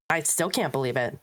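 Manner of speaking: ordinary talk, nothing marked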